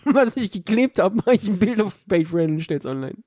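ordinary delivery

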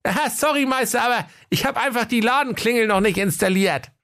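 very high apologetic shopkeeper; Dennis Höke's voice. Acted. Höke explains the missing shop bell, high and apologetic. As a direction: excuse yourself in a high comic voice. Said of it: "der umtriebige Dennis Höke sprach".

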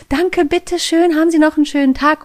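exaggerated high, sing-song gushing politeness; beaming with joy. Cashier sees the customer off, beaming with joy. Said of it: "freudestrahlend sagt".

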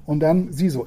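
ordinary talk

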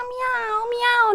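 high mock meow; cat meowing. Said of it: "dann sagen die Katzen so".

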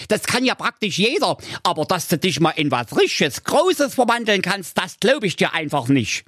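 high, cheeky boot-wearing dog voice; cheeky dog character. A narrator's reading. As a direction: goad the wizard with a high voice. Dog character speaks to wizard.

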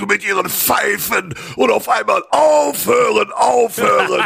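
Wilfried Schmickler impression. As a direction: rant like an outraged cabaret comic shouting stop. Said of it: "ich kann Wilfried Schmickler nachmachen".

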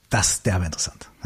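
leise gehauchte Bewunderung; flüstert tief beeindruckt, tiefer; geflüstert. Real, not acted. Staunender Kommentar zur Geschichte. (tief beeindrucktes Flüstern)

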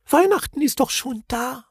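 tiny, very high voice; timid little creature. A narrator's reading. The little Knütt greeting the Moomins, tiny high voice. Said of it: "murmelte das Knütt".

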